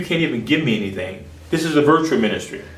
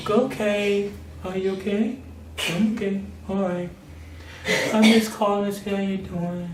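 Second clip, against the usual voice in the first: soft phone voice; 6 semitones higher, 7 dB quieter than usual. Retold or imitated.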